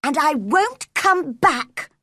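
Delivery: slow, high, defiant; angry vow. A narrator's reading. Mary storming out, angry final words. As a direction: bite off each word. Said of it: "Mary shouted in reply".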